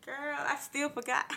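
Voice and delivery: high, drawn-out confession; playful admission. Real, not acted. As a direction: stretch it out in a high, joking voice. Jokes about her failing memory.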